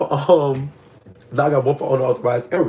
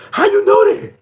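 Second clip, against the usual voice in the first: high, louder squeal of disbelief; real shock, voice jumping way up; 5 dB louder than usual. Real, not acted. Stunned by viewer's comment.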